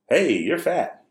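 high-pitched imitation of a child; blurting little kid. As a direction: call it out like a small son. Voicing small son's blurted remark.

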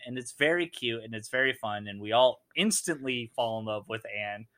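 ordinary delivery

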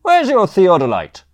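high, loud challenge across a lawn; shouting. A narrator's reading. Thin don testing the surveyors' story, shouting. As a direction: shout the question to someone far off. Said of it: "before shouting".

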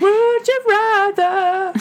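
high, slow announcer call; game show announcer. Acted. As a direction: sing out the game title.

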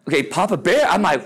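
ordinary talk, nothing marked

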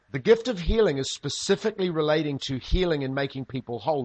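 normal talking voice

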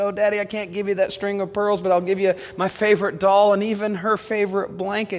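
high, lightly voiced child's plea; little girl pleading. Girl offering her daddy a doll instead, lightly voiced pleading child.

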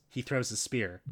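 ordinary talk, nothing marked

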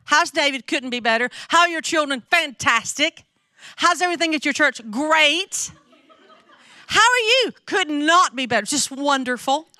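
high, gushing mock pleasantries; fake cheerful small talk. Church women lying to each other at lunch, mock-cheerful. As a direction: imitate ladies insisting all is perfect.

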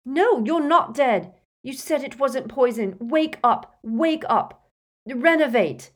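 frantic cry, high and loud; panicked shouting, insisting he wake; Harry. A narrator's reading. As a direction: plead desperately with a dying man. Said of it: "he cried".

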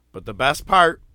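normal talking voice

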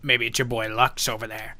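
ordinary talk, nothing marked

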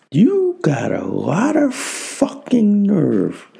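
slow, high indignation. Acted. Indignant rant.

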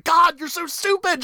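high-pitched mock exasperation; exasperated scolding. Host yelling at a fictional character, exasperated. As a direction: wail it at him in playful frustration.

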